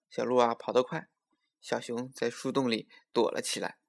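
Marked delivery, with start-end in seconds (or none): none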